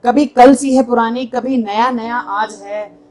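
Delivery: ringing openings, falling line ends; mushaira-style verse delivery; poetry recitation. Acted. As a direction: recite to an audience, measured and emphatic. Recites own poem to audience.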